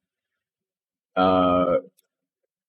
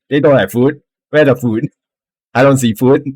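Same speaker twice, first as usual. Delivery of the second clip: higher and louder, shouted across; kobold shouting; 5 semitones higher, 6 dB louder than usual. Acted. Kobold shouts at Mick about the missing food. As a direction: yell it at Mick. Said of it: "shout at Mick".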